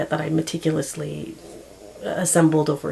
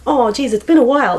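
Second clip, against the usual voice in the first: put-on higher, louder, bubbly hello to an old friend; friendly greeting; 9 semitones higher, 5 dB louder than usual. Greeting a half-remembered acquaintance at school, bubbly and loud. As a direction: gush over someone you bump into. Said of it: "I'll say something like".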